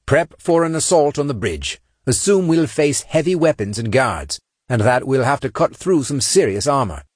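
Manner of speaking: brief the squad before an assault; squad leader giving orders. Acted. Saint readies his Marines to storm the bridge, commanding.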